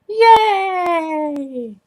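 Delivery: high, bright mock cheer; cheery yay. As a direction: cheer it like a show opener. Mock cheer for show name.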